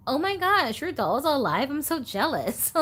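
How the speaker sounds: high, giddy fan reaction; mock jealous gushing. Acted reaction to haunted doll videos.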